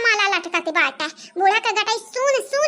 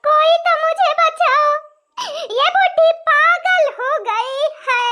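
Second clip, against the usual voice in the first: high-pitched theatrical wail for rescue; hammed-up distress call; drawn-out cry for help; 4 semitones higher than usual. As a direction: call out high, stretching the words.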